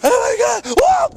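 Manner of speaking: high loud cry of alarm; startled yelp. Real, not acted. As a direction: yelp as the snow gives way. Sinking into the snow.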